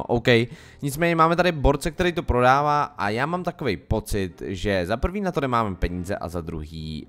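usual speaking voice

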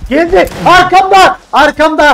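high, loud panicked cries; being chased. Real, not acted. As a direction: yell in fear as it closes in. Chased by monster in game.

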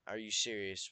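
ordinary delivery

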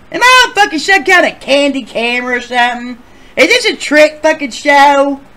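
high, loud comic indignation; hammed-up suspicious outcry; incredulous outburst. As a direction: demand it in loud disbelief. Acts out suspicious, incredulous reaction.

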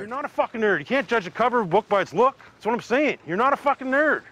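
loud, high-pitched insistent reassurance; emphatic insistence. Acted. Friend insisting Bubbles is no nerd, loud and emphatic.

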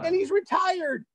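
very high, incredulous addition; amazed reveal. Real, not acted. Storyteller adding to the reveal after a wow, amazed. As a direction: top the reveal, pitched right up.

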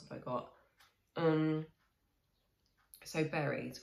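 talking between bites; chewing food. Real, not acted. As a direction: mouth partly full. Seen 1: Spoonful taken at -1.0; lips closed, chewing while looking down.

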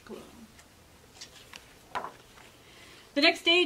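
lively picture-book reading with dramatic swings; storytime narration. A narrator's reading. Librarian reading a picture book to kids, storytime voice. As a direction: read it to a group of children, playing up the scary parts.

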